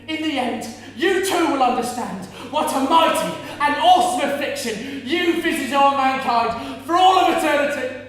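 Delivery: loud, high prophecy of God's own grief; shouted curse. Retold or imitated. Mother cursing God over her lost baby, loud shout. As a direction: hurl it at God like a threat. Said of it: "shouting these curses".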